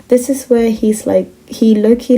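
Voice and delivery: ordinary delivery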